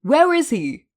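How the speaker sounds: high, loud demand. A narrator's reading. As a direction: bark the question. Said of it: "Hisashi demanded".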